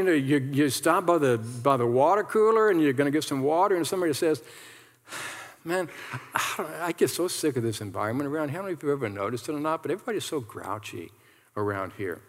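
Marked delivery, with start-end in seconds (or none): 5.6-12.2 hushed, fast, lower gossip; gossiping coworker. Acted. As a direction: lean in and gripe under your breath. Coworker gossiping at water cooler.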